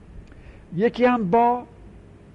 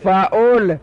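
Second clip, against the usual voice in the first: فعول said louder and clearer, falling at the end; louder repeat; 4 semitones higher, 5 dB louder, much slower than usual. Shown as a demonstration. Teacher repeating فعول louder for a student who asked. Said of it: "مثل چی؟".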